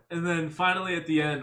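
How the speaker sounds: ordinary talk, nothing marked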